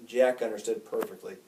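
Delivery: plain reading voice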